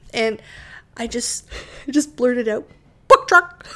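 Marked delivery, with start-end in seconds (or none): 3.1-3.7 high, loud squawked blurt; chicken-like blurt. Retold or imitated. Wife blurting her idea to her husband, chicken squawk. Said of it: "Like, like a chicken".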